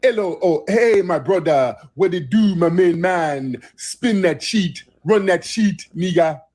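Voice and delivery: mock jive talk. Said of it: "tried to talk in jive talk".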